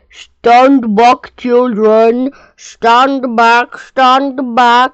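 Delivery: loud call, the word stand pushed hard each time; booming warning. A narrator's reading. Elephant shouting to children at the picnic table, booming warning. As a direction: bellow it so the children freeze. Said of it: "a deep voice from the jungle sounded".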